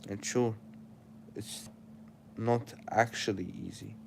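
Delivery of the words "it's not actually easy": ordinary delivery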